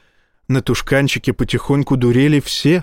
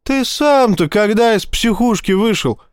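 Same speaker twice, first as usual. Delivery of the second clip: high snarling jab; 8 semitones higher than usual. A narrator's reading. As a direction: snap it at him like a cornered rat. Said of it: "окрысился Боровский".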